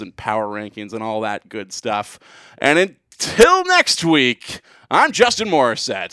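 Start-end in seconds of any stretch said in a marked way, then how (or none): 2.6-6.1 slow announcer voice; radio host sign-off. Acted. Show sign-off.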